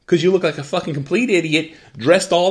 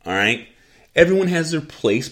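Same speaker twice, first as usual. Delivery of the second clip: ordinary delivery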